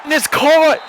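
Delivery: sports announcer erupting, pitch soaring on the catch; excited touchdown call. Real, not acted. Announcer calling Fresno State's touchdown, excited. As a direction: build from tense play-by-play to high, sustained cry.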